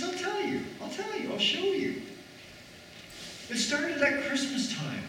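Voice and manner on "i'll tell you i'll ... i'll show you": Jesus voice. Acted. Preacher voicing Jesus, soft high.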